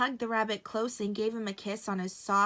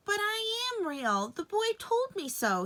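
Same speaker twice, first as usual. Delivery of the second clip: protesting rabbit; 12 semitones higher than usual. A narrator's reading. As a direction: insist in a small high voice. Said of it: "said the Velveteen Rabbit".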